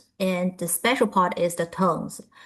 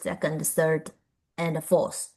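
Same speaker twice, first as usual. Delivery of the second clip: ordinary talk, nothing marked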